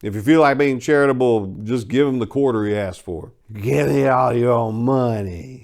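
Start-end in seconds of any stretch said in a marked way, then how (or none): 3.5-5.6 slow, slurred crackhead demand. A narrator's reading. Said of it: "he slurred".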